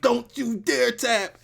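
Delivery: high, slow acted cry; hammed-up high-pitched warning, drawn out; mock desperate plea. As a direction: act out a wrestler urging his partner on. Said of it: "he's like".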